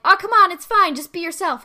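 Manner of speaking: high, loud coaxing; coaxing classmates. Retold or imitated. Classmates urge the girl to relax, high and loud. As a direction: push her warmly to be herself. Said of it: "they're like".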